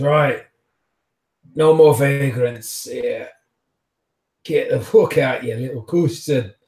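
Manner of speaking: heavy Scottish brogue, shouted out; a thug growling in thick Scots; thick Scottish accent. Acted. Bouncer throwing a vagrant out, thick Scottish shout. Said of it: "such a thick accent".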